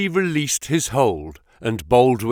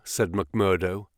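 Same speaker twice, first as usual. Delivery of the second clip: ordinary delivery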